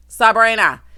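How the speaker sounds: loud shout of the name; scolding call. Pretend. Host scolding Sabrina for bringing men home, loud call. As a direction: holler it like a telling-off.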